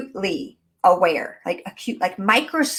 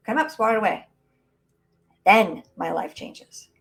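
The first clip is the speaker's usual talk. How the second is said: normal talking voice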